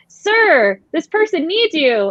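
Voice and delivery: a hint of a polite little kid; child's voice. Child telling her he is needed, lightly voiced. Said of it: "small child was like".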